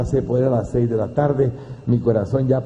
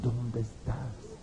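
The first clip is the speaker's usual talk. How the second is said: sad plea; 3 semitones lower, 8 dB quieter, much slower than usual. Retold or imitated. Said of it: "con gran tristeza".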